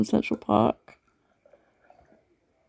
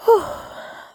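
tired sigh; 13 semitones higher than usual. Acted. End of long shift.

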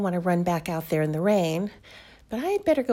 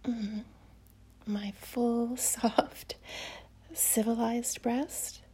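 sultry whisper; 4 dB quieter than usual. Acted. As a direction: whisper close and seductive. Whispers intimately throughout.

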